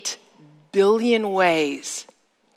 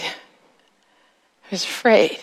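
"afraid" drawn out very slowly, voice thinned; slow fearful repeat; much slower than usual. Shown as a demonstration. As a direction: linger on "afraid" like a frightened child. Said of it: "because I was afraid".

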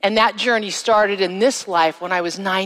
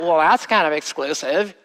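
skeptical objection; 4 semitones lower than usual. Retold or imitated. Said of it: "a lot of people have trouble with that".

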